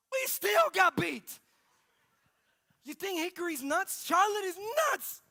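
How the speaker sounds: high, loud outburst of disbelief; exasperated shout. Retold or imitated. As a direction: shout it like you cannot believe it. Exasperated punchline of losing bids.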